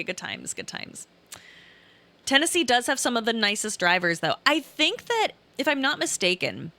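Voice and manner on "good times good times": trailing off to a whisper; wistful murmur; fading wistful. Real, not acted. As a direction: let it fade away fondly.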